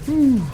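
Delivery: relieved whew. Real, not acted. Relief after escaping battle.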